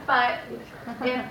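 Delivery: loud, breaking into laughter mid-sentence; amused laughing correction; laughing while talking. Real, not acted. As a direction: talk through the giggles at the wrong answer. Teacher laughing at kids' wrong guess.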